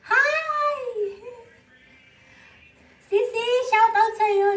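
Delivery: high, loud bright hello; excited greeting. Real, not acted. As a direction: call out happily to a viewer. Greeting viewer loudly on stream. (masiglang pagbati)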